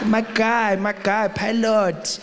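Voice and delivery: impressed passenger. Retold or imitated. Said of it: "You're like".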